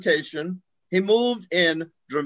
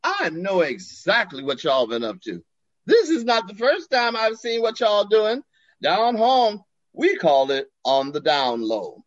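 put-on Southern accent; 4 semitones higher than usual. Glover's voice continues.

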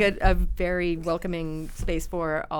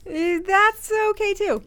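high, slow clerk reply; 12 semitones higher, 5 dB louder, slower than usual. Pretend. Clerk answering the yelling customer.

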